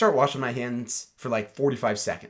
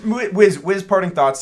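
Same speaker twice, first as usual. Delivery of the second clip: loud, higher call to a co-host; 7 semitones higher, 8 dB louder than usual. Real, not acted. Host calling on a co-host for parting thoughts, loud call. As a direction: hail him across the table to wrap up.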